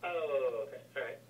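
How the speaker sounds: normal talking voice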